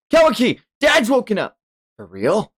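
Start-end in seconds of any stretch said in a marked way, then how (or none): 0.1-0.6 high, loud shout of a name; calling out. Acted. Boruto shouting to Kawaki, calling out. 0.8-1.6 high and loud, bursting with news; excited shout. Acted. Boruto telling Kawaki his dad woke, excited shout. Boruto announces father awake.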